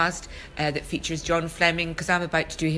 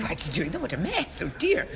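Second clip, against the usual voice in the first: hushed, higher mock tutting; shocked audience; 3 semitones higher, 6 dB quieter than usual. Inverness audience watching fake sick, hushed tut-tutting. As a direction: whisper like a prim appalled theatregoer. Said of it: "heard all these lovely Invernessians go".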